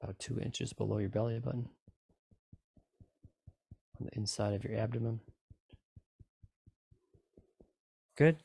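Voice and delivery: calm, even meditation guide voice; guided meditation. Acted. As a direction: guide them through the tapping gently. Leads a tapping meditation.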